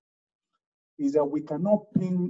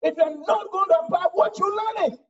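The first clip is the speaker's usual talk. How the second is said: loud, fast sermon climax; fiery preaching; 10 semitones higher, 4 dB louder, faster than usual. Acted. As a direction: preach it hard to the pews. Preacher exhorting the congregation.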